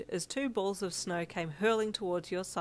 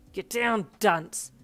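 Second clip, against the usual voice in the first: loud, rough-tempered order; yell; 2 semitones higher, 9 dB louder, much slower than usual. A narrator's reading. Bakugo warning a teammate, yelling. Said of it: "you heard Bakugo yell".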